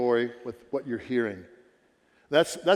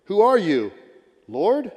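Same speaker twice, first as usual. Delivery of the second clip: higher and louder, crying out to the Lord; louder pleading cry; 5 semitones higher, 5 dB louder than usual. Acted. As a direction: call out the question, desperate. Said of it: "And I answered".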